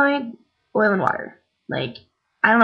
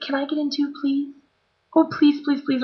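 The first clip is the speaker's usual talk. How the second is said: higher, softer imitated begging; retold pleading; 5 semitones higher, 5 dB quieter than usual. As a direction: plead like a friend asking a favour. Friend begging to get in too.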